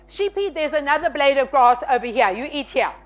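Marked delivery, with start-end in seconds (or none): none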